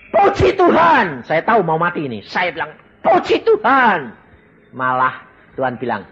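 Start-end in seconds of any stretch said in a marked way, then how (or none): 0.1-1.2 much higher and louder, slow ecstatic cry; shouted praise. Retold or imitated. Dying man shouting praise to God, loud and slow. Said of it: "tak terakhir saya teriak". 3.0-4.1 higher, louder, slow cry of praise; shouted praise. Retold or imitated. Dying man repeating his praise, a loud slow cry. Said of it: "tak terakhir saya teriak".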